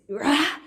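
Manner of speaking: loud mock roar at the top of the lungs; retold scream. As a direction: belt out his roar as you retell it. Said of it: "at the top of his lungs".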